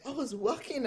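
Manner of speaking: normal talking voice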